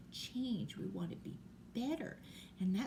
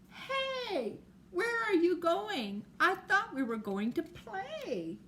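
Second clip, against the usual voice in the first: much higher, louder call with a laugh; surprised child calling; 10 semitones higher, 5 dB louder, slower than usual. Acted. Friend calling after the girl walking away, high surprised call. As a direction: call after her, puzzled and a bit hurt.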